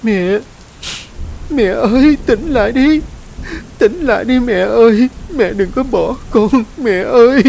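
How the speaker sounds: put-on high, loud, desperate child's plea; a small child begging her mother to wake; child crying for mother. A narrator's reading. Child begging unconscious mother to wake, high frantic cry. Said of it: "miệng luôn gọi".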